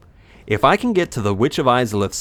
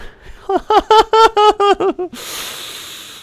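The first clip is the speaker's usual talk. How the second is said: very high staccato cackle; long shrieky giggle in character; 4 dB louder than usual. Acted. German character laughs at Gunslinger, high cackle. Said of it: "my old German voice".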